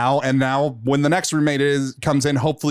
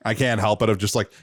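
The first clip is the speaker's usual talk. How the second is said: ordinary delivery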